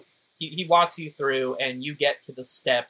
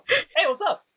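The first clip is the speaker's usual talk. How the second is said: Tyler voice. Acted. GM voices Tyler answering phone.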